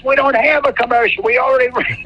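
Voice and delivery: high-pitched imitation of a frantic director; exasperated director. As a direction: mimic the director's exasperated outburst. Director keeps yelling in retold scene.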